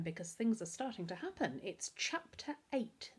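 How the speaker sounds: usual speaking voice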